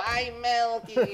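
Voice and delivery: high, slow wicked witch wail; mock melting cry. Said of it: "you go".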